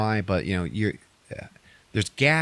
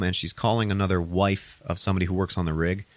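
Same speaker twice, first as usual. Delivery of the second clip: usual speaking voice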